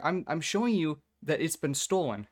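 student begging a teacher for help, frustrated; pleading frustration. Retold or imitated. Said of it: "I'm like".

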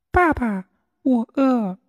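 very high small duckling voice; a little chick asking for food; 小鸭子喊饿; hungry duckling. A narrator's reading.

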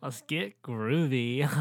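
slow groovy surfer drawl; surfer dude voice. Pretend. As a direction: stretch it out like a beach bum. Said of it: "surfer voice".

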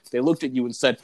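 ordinary talk, nothing marked

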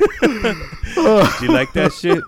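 high, loud, slow laughing question; talking through laughter. Real, not acted. Joker asking his friend about the story, laughing hard. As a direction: squeeze the words out while cracking up.

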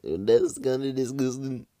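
high, loud, slow mock disgust; mocking prissy voice. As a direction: imitate a squeamish girl. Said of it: "all females are like".

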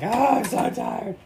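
high, slow, hammed-up moaning; whiny tired voice. Mocking his own tiredness while beating eggs, slow whiny moan. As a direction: drag the words out like you're exhausted. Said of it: "and I'm like".

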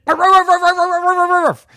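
rapid, loud high-pitched barks; dog barking. Acted. Dog comes running to the family, barking. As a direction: yap frantically like an excited dog. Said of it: "the dog came running and said".

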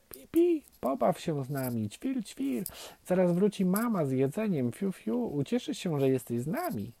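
high-pitched, cheerful chirping chatter; twittering baby birds. A narrator's reading. Nestlings invite the duckling to play, joyful twittering. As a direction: chirp it like eager little nestlings. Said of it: "szczebiotały jedno przez drugie".

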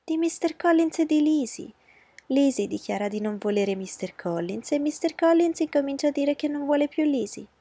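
high, pushy voice of a fussing mother; a flustered matron laying down the law; anxious mother. Acted. Mrs Bennet explaining excitedly. (madre agitata, voce alta e insistente)

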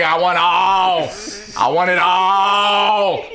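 slow triumphant shout. Real, not acted. Repeating the brag.

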